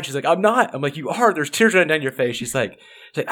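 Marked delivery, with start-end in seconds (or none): 0.3-0.7 higher, lightly voiced denial; a hint of a stubborn kid saying no; defiant daughter. Daughter denies crying. 1.0-2.4 higher, pointed rebuttal; insistent mom. Retold or imitated. Mom insists daughter is crying.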